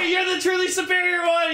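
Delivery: high excited yelling quote; excited shout. Retold or imitated.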